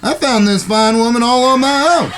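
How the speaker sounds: pleased as punch, pitched well up; eager cowboy brother. Acted. Brother hurrying his siblings to the stagecoach, high and eager.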